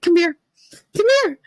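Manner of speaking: high eager coaxing call. Retold or imitated. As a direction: lure him in for a hug. Calling deli guy for hug.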